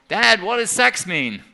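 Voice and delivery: put-on high, loud, slow child's holler. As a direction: call out from the upstairs window. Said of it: "he hollered out".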